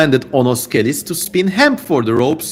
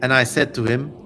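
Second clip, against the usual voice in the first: normal talking voice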